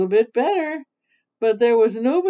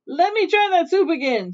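calling out; 8 semitones higher, 4 dB louder than usual. A narrator's reading. Aunt Nasty calling from sickbed.

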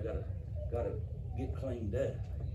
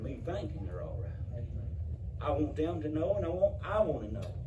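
preaching at full force; 4 semitones higher than usual. Acted. Preacher to his church, crying out about hell at full force. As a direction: drive it home to the congregation, full force.

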